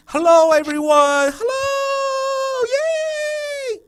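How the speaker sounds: very high, squealed, drawn-out excited hello; crazy teacher greeting. Shown as a demonstration. Teacher grabbing kindergarteners' attention at class start, crazy excited. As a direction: cheer it loud and high like a kids' entertainer. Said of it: "You gotta start something like that".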